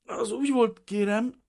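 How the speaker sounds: very high, loud, anxious pleading; frightened explaining. A narrator's reading. Pale refugee explaining to the official, frightened. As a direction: plead your case high and nervously. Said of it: "magyarázta Vrana Bazil sápadtan".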